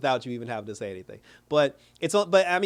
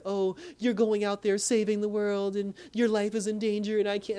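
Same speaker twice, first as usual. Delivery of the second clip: higher-pitched imitated complaint; mildly hammed-up worried protest; mock lament; 6 semitones higher than usual. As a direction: put on a light, fretful girlfriend voice. Imitates Harper's future relationship complaint.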